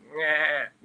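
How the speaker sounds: imitated goat bleat. Goat standing out in the rain with nothing, bleating. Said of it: "just being a goat out in the rain".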